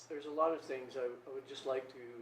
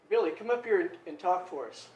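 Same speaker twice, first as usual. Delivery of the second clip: lightly voiced teacher, louder; imitated teacher; 5 semitones higher, 10 dB louder than usual. Fifth grade teacher to new kid, imitated calling-up. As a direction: call the pupil up to the front. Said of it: "she'd say".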